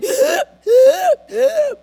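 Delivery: sharp strained gasp for air; choking gasp. Shown as a demonstration. Preacher miming choking, a strained gasp. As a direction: gasp like you can't breathe out.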